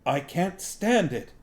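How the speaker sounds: high, strained outburst; raging thought, pitched high; inner rage. A narrator's reading. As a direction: burst out in pent-up frustration. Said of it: "Garion raged silently".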